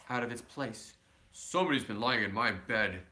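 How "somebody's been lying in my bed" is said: lower, louder gruff papa bear; great gruff bear voice. Acted. Reading to child, papa bear grumbling about his bed. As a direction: rumble it like a big grumpy bear. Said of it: "in his great, rough, gruff voice".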